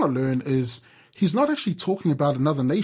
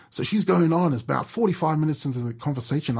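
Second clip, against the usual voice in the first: usual speaking voice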